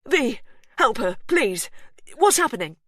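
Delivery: high, loud begging; panicked pleading. A narrator's reading. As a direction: beg for help in terror. Hugh pleading.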